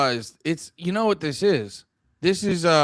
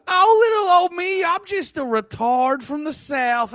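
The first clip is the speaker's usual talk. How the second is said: high, slow Southern drawl, mocking; hammed-up Southern lady; 11 semitones higher than usual. Comedian mocking the woman in the video, exaggerated Southern drawl. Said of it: "I'm still in Southern lady voice".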